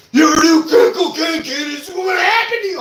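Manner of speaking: high, loud bellowing scare-tactic shout; mock drill-sergeant yell. Mimicking an Intervention tough guy yelling at a boy, shouting. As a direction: roar it like a jail guard scaring a kid. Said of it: "They take, like, a four-year-old boy".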